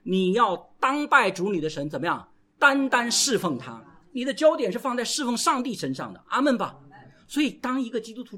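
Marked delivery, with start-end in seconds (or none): none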